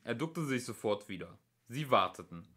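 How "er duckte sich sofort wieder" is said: ordinary delivery, nothing marked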